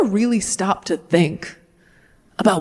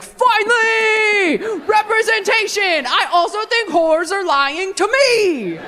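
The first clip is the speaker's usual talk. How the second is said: much higher, slow whooping cheer; mock triumphant cheer; 10 semitones higher, slower than usual. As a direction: cheer like vindicated churchmen. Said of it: "all the men were like".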